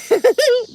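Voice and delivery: short, loud burst of laughter; high laugh. Real, not acted. Host reacting to a viewer's comment, high short laugh. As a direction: break into a high chuckle.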